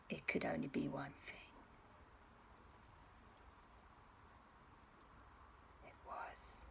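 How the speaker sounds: hushed storyteller whisper; suspenseful whisper. A narrator's reading. Hushed build-up before the reveal.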